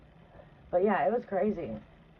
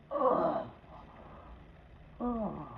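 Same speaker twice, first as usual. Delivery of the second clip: ordinary talk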